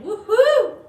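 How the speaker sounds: loud, high whoop of joy; happy cheer. Real, not acted. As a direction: let out a big celebratory whoop. Playful cheer during shake-out.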